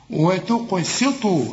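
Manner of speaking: "وتقسطوا" slowly, thin hissed s plus "ee"; clear seen with kasra. Shown as a demonstration. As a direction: keep the s of "وتقسطوا" light. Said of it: "طلعت لك السين سين".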